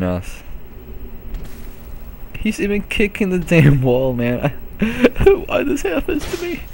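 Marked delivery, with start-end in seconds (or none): 4.8-6.6 squeaky high laughing whine; laughing disbelief. Real, not acted.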